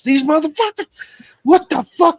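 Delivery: high, loud furious yell; screaming. Retold or imitated. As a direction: scream it from the back office, enraged. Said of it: "you hear him screaming".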